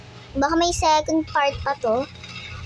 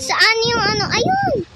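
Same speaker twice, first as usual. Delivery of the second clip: loud, high-pitched search rising to a cry; excited spotting; 6 semitones higher, 6 dB louder, slower than usual. Real, not acted. Player hunting for something in the game spots it, excited shout. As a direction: call out louder, leaping up in pitch on the find.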